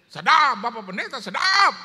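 high, loud shouted approval; imitated Ambon congregation. Ambon church cheers the preacher, high loud shouts. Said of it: "itu gereja Ambon sampai".

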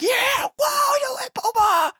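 very high, whooping scream; screaming celebration. Acted. Dad cheering his daughter's toilet success, screaming. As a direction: go wild like a stadium fan. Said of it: "And I'm screaming".

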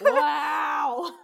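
loud, high outraged wow; shouted wow. Real, not acted. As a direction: yell it in mock outrage. Dismay at low rating.

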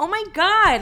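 high, loud panicked exclamation; mock panic. Reacting to the intrusive thought, mock panic. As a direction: exclaim it in hammed-up alarm.

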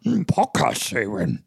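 slow Yoda croak with a thoughtful mm. Pretend. Co-host answering in Yoda voice, slow and odd. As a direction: hum first, then reverse the words slowly like Yoda. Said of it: "talk like Yoda".

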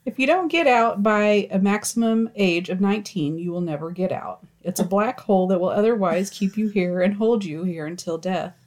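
usual speaking voice

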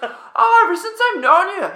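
fast, very high yell; screaming Marcus. Retold or imitated. Teammate reacting minutes after meeting him, screaming. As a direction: shriek it in imitated Aussie outrage. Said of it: "screaming and yelling".

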